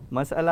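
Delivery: مسئلہ pronounced "mas-ala"; teacher's careful "mas-ala"; correct pronunciation. Shown as a demonstration. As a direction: give مسئلہ three syllables, separating "mas" from "ala". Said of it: "اس کا صحیح تلفظ ہے".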